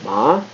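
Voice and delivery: ma rising like a question; second tone ma, rising. Shown as a demonstration. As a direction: lift ma up as if asking. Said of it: "almost like asking a question".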